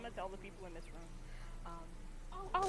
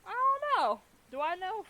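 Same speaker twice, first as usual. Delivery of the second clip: high, loud confused squeal; silly high voice; 10 semitones higher, 22 dB louder, slower than usual. Acted.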